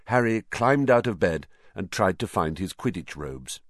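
ordinary delivery

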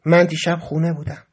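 usual speaking voice

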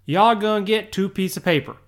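fast, slurred "Y'all gonna get two piece of paper"; casual Southern pronunciation. Retold or imitated. Teacher quoting own slip to English club, casual Southern pronunciation. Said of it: "this is exactly what I said".

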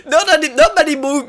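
high, incredulous, through laughter; laughing indignation. Real, not acted. Irish host objecting to dirt on the actress's face, laughing indignation. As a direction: protest it while cracking up.

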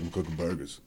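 low, quiet dopey Bob voice; Bob's voice. Retold or imitated. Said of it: "that's how Bob talks".

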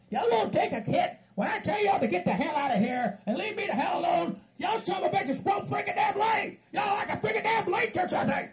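high-pitched, exasperated shouting; angry tirade. Acted. Orders visitors to get out.

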